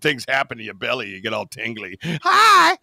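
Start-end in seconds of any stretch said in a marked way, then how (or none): 2.0-2.8 very high, loud squeaky greeting; giddy high squeal. Acted. Host tingly over French accent, giddy squeal. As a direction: squeal it like a smitten fan, hammed up.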